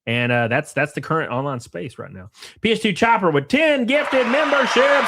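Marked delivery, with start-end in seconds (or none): 2.6-5.1 loud, high hype for a gifted sub; excited shoutout. Real, not acted. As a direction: hype up the donor like a stream host. Streamer hypes gifted memberships.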